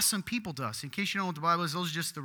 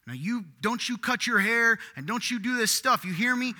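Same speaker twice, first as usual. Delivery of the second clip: stern legalist; 6 semitones higher, 6 dB louder than usual. Retold or imitated. Mocking legalistic preacher.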